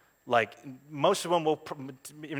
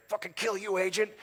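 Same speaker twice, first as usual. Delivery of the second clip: angry man; 8 semitones higher than usual. Acted. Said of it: "play rough and tumble angry man with a gun".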